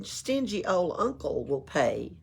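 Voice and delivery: plain reading voice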